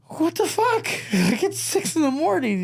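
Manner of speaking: very high mock squeal of shock; incredulous squeaky protest, breaking into a laugh; stunned disbelief. Son reacting to dad's morning rampage, squeaky disbelief.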